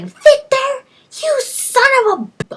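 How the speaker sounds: hammed-up outraged yell; furious shrieking wife. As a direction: play the betrayed wife screaming at him.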